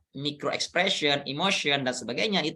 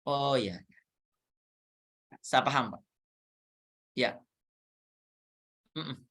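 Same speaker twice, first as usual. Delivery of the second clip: soft, quieter little affirmations; calm acknowledging; 6 semitones lower, 5 dB quieter, slower than usual. Shown as a demonstration. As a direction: show the listener you hear them, quietly. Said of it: "Angguk sewajarnya gitu ya".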